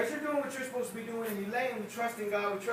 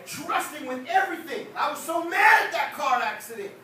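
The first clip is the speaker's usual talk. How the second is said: much higher and louder, shouting; preaching full force; 7 semitones higher, 7 dB louder than usual. Real, not acted. Preacher confessing anger to the church, shouting. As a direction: build it up into a shout at the Lord.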